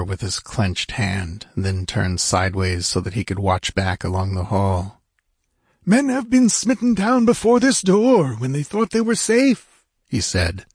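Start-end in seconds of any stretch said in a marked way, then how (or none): 5.8-9.8 high-pitched voice for an anxious warrior; anxious character voice. A narrator's reading. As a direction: warn them in a high, strained character voice. Narrator voices Techotlal's warning in dialogue.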